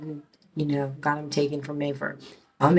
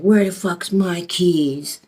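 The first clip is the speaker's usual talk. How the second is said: loud, drawn-out mock yell; retold holler, slow and loud; retold angry demand; 3 semitones higher, 7 dB louder, much slower than usual. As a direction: imitate an irate man bursting in, stretching the words. Man bursts in demanding his keys.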